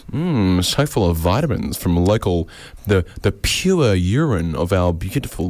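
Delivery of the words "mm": ordinary talk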